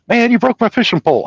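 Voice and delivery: high-pitched imitation of a griping friend; mock complaint. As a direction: whine it up high as the wronged friend. Friend complaining, voiced in retold story.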